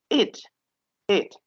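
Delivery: short clipped it syllable, repeated; extra syllable. Shown as a demonstration. Teacher showing the added syllable to students. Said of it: "It could be".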